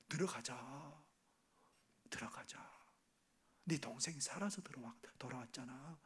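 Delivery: soft, pleading father's hush; hushed coaxing. Acted. Father quietly begging his elder son to come in, hushed. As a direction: coax the son back in, barely above a whisper.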